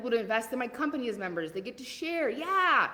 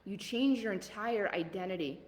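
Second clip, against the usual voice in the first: ordinary talk, nothing marked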